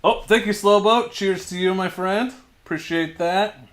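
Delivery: high, bright burst of thanks; cheerful thanks. Real, not acted. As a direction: thank him like he made your day. Thanking a viewer on stream.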